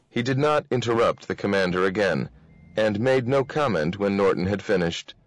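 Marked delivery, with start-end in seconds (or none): none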